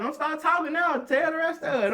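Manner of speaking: high, loud mocking bark; taunting shout. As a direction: rub it in, loudly. Officers taunting.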